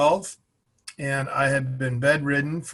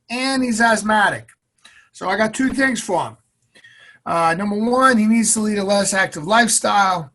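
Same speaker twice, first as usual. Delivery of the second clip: high, loud nasal Boston doctor lecturing; nasal Boston accent doctor to the mom, higher and louder; nasally Boston accent; 6 semitones higher, 7 dB louder than usual. Retold or imitated. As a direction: play the Boston lung doctor explaining the chart, pitched up. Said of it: "a nasally Boston accent".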